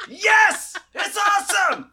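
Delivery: excited coach cheering. Retold or imitated.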